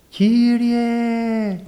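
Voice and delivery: high, strained call in a different timbre; a high, strained, lightly voiced cry of Κύριε; imitated cry to the Lord. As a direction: mimic the wife crying out Κύριε. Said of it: "τόσο δυνατά".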